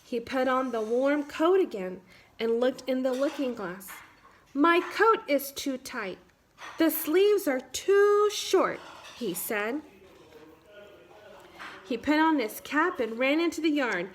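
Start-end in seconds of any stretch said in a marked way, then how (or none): 4.5-8.8 higher and slower, savoring each word; delighted discovery. Acted. Boy gleefully telling his mirror his coat no longer fits. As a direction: let the joy grow as you realize.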